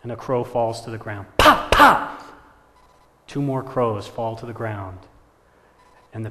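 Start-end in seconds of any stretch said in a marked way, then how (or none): 1.4-2.2 loud, high vocal pops; sharp double bang noise; two gunshot pops. Acted. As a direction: imitate two quick shots, explosively. Imitates two more gunshots.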